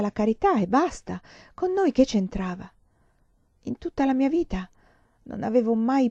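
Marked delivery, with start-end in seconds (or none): none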